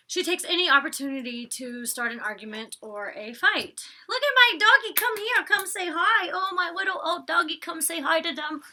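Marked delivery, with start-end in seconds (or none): 4.1-8.6 high doggy-talk voice. Real, not acted. Talking to her dog.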